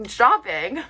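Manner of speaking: usual speaking voice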